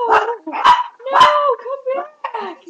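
loud, high cry for them to come back; desperate loud call, slow on come back. Real, not acted. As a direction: shout after someone leaving. Friend leaving, calling out.

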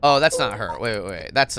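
ordinary delivery

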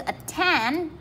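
at-TEND, second syllable stressed. Shown as a demonstration. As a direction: lift your voice on the TEND of attend. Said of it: "lên giọng này".

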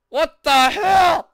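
slow, high, loud exclamation; outraged disbelief. Real, not acted. Player facing a new level, outraged yell. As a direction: shout it in baffled outrage.

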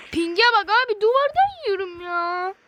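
loud, frustrated rant at the lag; real gamer rage, loud; angry outburst. Real, not acted. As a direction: blow up at the ping, slowing on the end. Lag ruining his shots.

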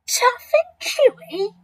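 high, slow, outraged echo; incredulous cry. A narrator's reading. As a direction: squeal it back in hammed-up disbelief. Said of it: "cried the enormous crocodile".

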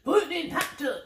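punchy, half-voiced soul-singer yelps. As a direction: bursts of hammed-up showman shouts, imitating a skit. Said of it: "acting like he was [name]".

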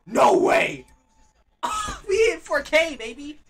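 loud, high celebration; triumphant high-pitched whoop; ecstatic shouting. Real, not acted. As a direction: yell it in thrilled disbelief. Stream reaches four K quality.

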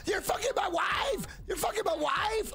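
high repeated mock yell; retold furious yelling. Betrayed husband yelling repeatedly in the car, high mock yell. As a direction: shout the same line again and again like a metal chorus. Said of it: "he kept yelling it over and over".

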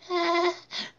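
put-on high girlish giggle. Little girl giggling at her toy duck. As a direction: giggle like a delighted kid. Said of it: "D W giggled".

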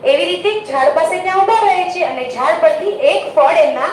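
plain reading voice

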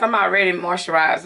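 normal talking voice

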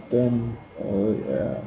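ordinary delivery

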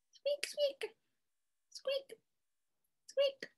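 tiny, very high, soft squeaks; mouse squeak. Pretend. Reader plays mouse for the children, squeaking. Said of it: "squeak like a mouse".

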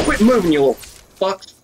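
high, frustrated outburst that drops at the end; exasperated gamer cursing. Real, not acted. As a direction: snap high at targets you keep missing. Frustrated at missing game enemies.

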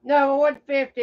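normal talking voice

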